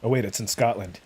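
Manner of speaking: ordinary talk, nothing marked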